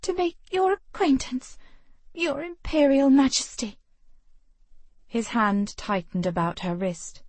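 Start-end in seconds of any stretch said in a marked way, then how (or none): 0.0-3.8 put-on high, breathless young lady; pinned and gasping, but defiant; young woman voice. Female character voiced by narrator.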